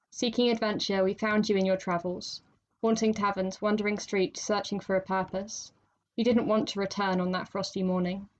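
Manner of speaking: measured, low, quiet poem recital; poem reading. Acted. Poet reading her own poem to the podcast hosts, measured recital. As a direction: read it like a quiet spoken-word piece.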